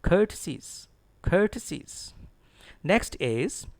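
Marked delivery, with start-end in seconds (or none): 0.0-2.1 courtesies with COUR high, said "kur"; careful model courtesies, first syllable stressed. Shown as a demonstration. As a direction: start courtesies high on KUR and fall away. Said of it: "Stress is on C O U R".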